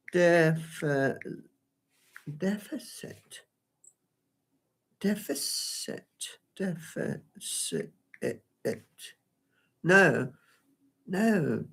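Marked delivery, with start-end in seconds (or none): 2.4-7.9 testing deficit in a whisper. Real, not acted. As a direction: whisper the word slowly to herself. Testing the word under her breath.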